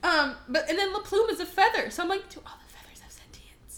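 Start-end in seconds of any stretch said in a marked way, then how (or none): 2.3-3.8 hushed, barely-there whisper; horrified whisper. Retold or imitated. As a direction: whisper the awful realization. Horrified thought about the feathers.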